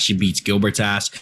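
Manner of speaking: ordinary talk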